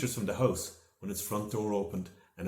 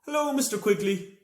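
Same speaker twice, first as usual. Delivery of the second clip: high, loud hello from the sky; shouted greeting; 13 semitones higher, 5 dB louder than usual. A narrator's reading. Joe to the neighbour below, loud cheerful shout. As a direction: call down cheerfully. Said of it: "Joe shouted".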